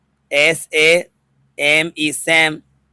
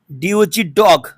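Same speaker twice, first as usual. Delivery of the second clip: ordinary delivery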